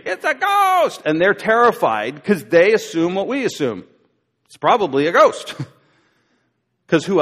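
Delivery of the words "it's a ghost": much higher, quick mock shriek of fright; mock scream. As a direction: yelp it like a panicked fisherman. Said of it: "They actually scream".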